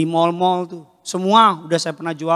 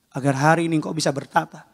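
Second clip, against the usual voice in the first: ordinary delivery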